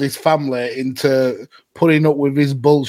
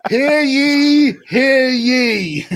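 town crier; 11 semitones higher, much slower than usual. Pretend. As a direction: call it out to the square.

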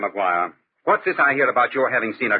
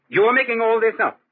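exasperated rebuke; 11 semitones higher, 4 dB louder than usual. Acted. Master scolds valet over ghost tale.

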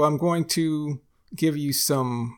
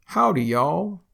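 "Howdy, y'all" in a Texan lilt, higher; Texan greeting; 5 semitones higher, 4 dB louder than usual. Shown as a demonstration. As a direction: sing out a Texan hello. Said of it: "So an example is".

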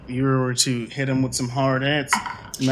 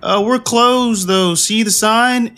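much higher, louder, nervous doorman; a jumpy doorman behind the slot; 10 semitones higher, 4 dB louder, much slower than usual. Acted. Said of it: "you hear a voice say".

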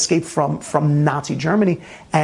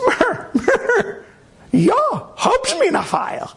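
much higher, slow hammed-up German; mock Nazi officer; much slower than usual. The officer answers the rabbi's request, high mock German. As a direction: squeak it out like a flustered officer.

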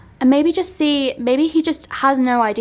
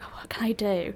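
unvoiced, softer scheming whisper; 3 semitones lower, 9 dB quieter than usual. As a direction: whisper it to yourself, plotting. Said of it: "I was like".

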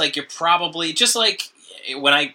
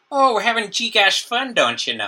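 put-on Minnesotan accent; 8 semitones higher than usual. Mocking the Minnesotan accent to the co-host, hammed-up Minnesotan. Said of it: "And Minnesotan".

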